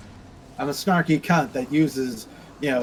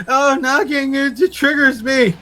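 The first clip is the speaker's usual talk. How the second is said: high, loud whiny mock of someone offended; mock offended snowflake; 8 semitones higher, 6 dB louder than usual. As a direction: whine it like a fragile complainer, mocking them. Mocking a triggered person.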